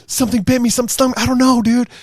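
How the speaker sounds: high panicky pleading; retold panic. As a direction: replay the moment of being stung. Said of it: "And I'm like".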